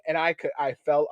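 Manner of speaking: ordinary talk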